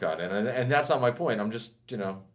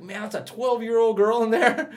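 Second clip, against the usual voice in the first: high, slow, loud disbelief; amazed; 11 semitones higher, 5 dB louder than usual. Retold or imitated. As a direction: stretch it out in astonishment. Shocked reaction retold.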